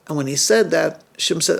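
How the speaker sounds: ordinary delivery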